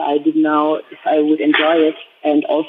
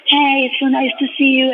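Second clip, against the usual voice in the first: high excited greeting; 8 semitones higher than usual. Retold or imitated. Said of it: "and she's like".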